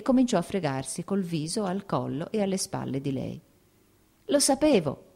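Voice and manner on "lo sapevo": put-on higher little-boy voice, drowsy and glad; a light child voice, pleased with himself; boy just waking sees his mother; sleepy boy. A narrator's reading. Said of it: "disse aprendo gli occhi".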